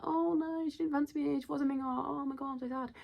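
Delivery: high, whiny mimicry of hurt pride; mock butthurt. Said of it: "As one does, he was butthurt".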